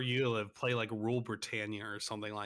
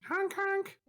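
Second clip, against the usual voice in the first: honking noise. Acted. Imitates a horn.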